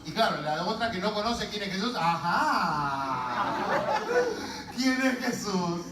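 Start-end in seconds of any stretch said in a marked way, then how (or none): 2.0-5.8 long drawn-out, knowing question; suspicious pondering. Acted. Sibling wondering who Jesus is, suspicious pondering. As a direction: stretch it out as if suspecting an affair. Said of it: "Como pensando, a ver, en una aventura".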